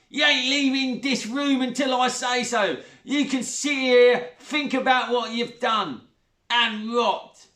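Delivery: high, loud scolding; a bully laying down the law; Sheila, bullying stepmother. A narrator's reading. Stepmother locks Zoe in.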